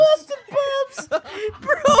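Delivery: high laughing. Real, not acted. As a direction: squeal it while cracking up. Cracking up at joke.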